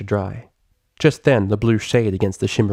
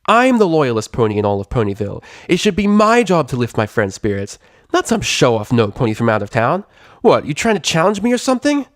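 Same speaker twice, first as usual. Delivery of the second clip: louder, cocky tomboy voice; Rainbow Dash, jealous challenge; 5 semitones higher, 3 dB louder than usual. Acted. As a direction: puff up and pick a fight.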